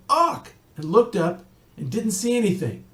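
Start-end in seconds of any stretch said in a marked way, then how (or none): 0.1-0.5 high sudden squawk; startled chicken squawk. Acted. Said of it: "And Chicken Little said".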